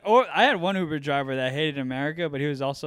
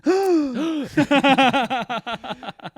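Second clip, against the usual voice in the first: loud, high exaggerated gasp; mocking gasp; 10 semitones higher, 5 dB louder than usual. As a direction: make fun of an overexcited crowd's gasp. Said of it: "their stupid reaction".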